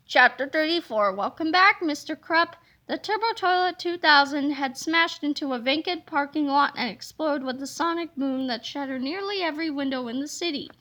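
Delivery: plain reading voice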